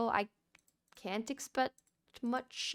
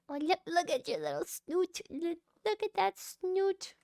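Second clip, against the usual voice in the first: cooing at a pig; 8 semitones higher than usual. Real, not acted. Player cooing at her pig, higher sing-song baby talk. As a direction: gush over the pig's cute snout.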